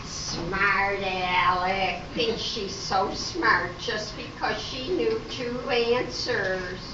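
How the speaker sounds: jealous dig not meant for the mic; sour aside. Acted. Trixie jealous aside about Jane.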